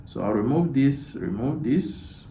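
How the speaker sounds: soft muttering under breath; muttering to self. Real, not acted. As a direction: say it quietly to yourself. Muttering to self while clicking.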